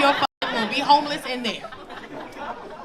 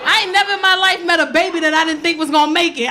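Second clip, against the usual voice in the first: usual speaking voice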